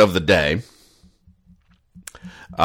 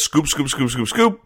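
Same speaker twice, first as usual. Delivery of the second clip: 1 sound-effect chant; 5 semitones higher than usual. Acted. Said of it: "a full panel that just says".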